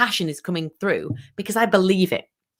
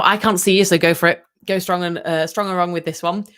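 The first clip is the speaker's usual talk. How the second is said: ordinary delivery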